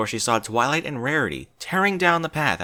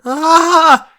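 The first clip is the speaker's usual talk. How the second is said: sudden high shriek of shock; scream; 14 dB louder than usual. A narrator's reading. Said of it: "she screamed".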